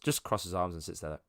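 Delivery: usual speaking voice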